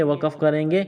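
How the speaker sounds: usual speaking voice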